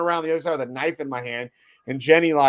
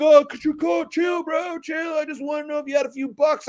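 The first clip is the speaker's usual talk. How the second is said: mock panhandler voice; 12 semitones higher than usual. Imitating the panhandler's reply.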